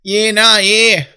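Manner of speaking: high, loud bogan Aussie drawl; bogan accent. Shown as a demonstration. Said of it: "so you have the Bogan accent".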